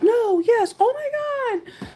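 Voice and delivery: high, excited mock yell; mock screaming. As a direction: squeal at the TV. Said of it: "I was, like, screaming".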